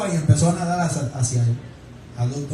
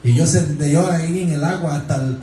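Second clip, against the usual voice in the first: ordinary talk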